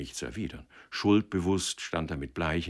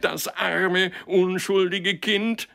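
very high, drawn-out mocking wail with laughter; strained, slow, sarcastic laughing cry; convulsive laughter; 14 semitones higher, 7 dB louder, slower than usual. A narrator's reading. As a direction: laugh bitterly through the words. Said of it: "lachte krampfhaft auf".

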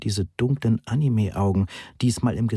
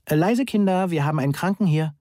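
much higher, fast, forced cheer; a bit too cheerful, too shrill; 8 semitones higher than usual. A narrator's reading. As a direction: hush the kids with strained brightness. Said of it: "Monis Stimme war ein wenig zu fröhlich, ein wenig zu schrill". (zu fröhlich und zu schrill)

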